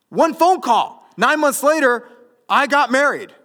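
high, loud, punched-out phrases; emphatic shout. Real, not acted. Pastor countering doubters with his own story, emphatic shout. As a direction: proclaim it at full force to the doubters.

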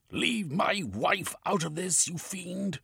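shouting. A narrator's reading. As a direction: defend your wife from the villain. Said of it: "Cornucope shouted".

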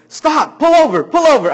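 sudden high, loud command to stop; sharp shouted stop order; urgent shout. Retold or imitated. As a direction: bark it out like a panicked passenger. Salesman suddenly orders driver stop.

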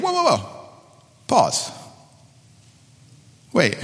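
a sudden high "whoa" that cuts off the ideas; halting exclamation. Retold or imitated. Michael stopping the pastor in an elders meeting, a mock halt. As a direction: stop the rush of ideas. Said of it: "He said".

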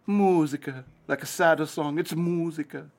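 sing-song mournful Italian accent; sad Italian accent. Shown as a demonstration. Host showing how Italian sounds sadder, mournful Italian accent. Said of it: "In Italian, it sounds more, uh".

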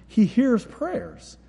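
"hears" lifted and drawn out, slow; stress on hears. Acted. Pastor driving home his point, slow with "hears" stressed. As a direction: lean hard on "hears". Sermon emphasis on hearing.

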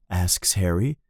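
usual speaking voice

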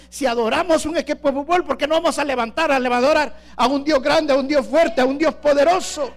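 loud, ringing sermon shout; fervent preaching. Real, not acted. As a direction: preach it out to the whole church. Preaching at full force. (predicación a todo pulmón)

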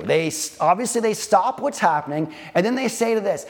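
ordinary talk

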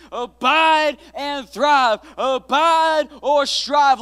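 loud, slow, high title proclamation; preached titles. Shown as a demonstration. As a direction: boom the title out like a revival preacher. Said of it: "title declared at full force".

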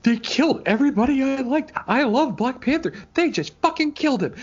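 put-on high, loud distraught child's voice; boy in the theater, high and upset; upset kid. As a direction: voice a heartbroken little boy. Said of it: "He was like".

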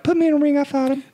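high, lightly voiced [name]; a hint of [name]. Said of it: "[name] was like".

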